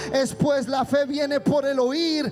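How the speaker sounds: loud, relentless exhortation; full-force preaching. Real, not acted. Preacher urging congregation to come with faith, full-force preaching. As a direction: drive every phrase home at full voice.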